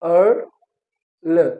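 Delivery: अर्ल stretched very slowly with a long er sound; drawn-out er. Shown as a demonstration. Teacher modelling the girl vowel for students, drawn out. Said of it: "इसकी pronunciation कैसे होगी".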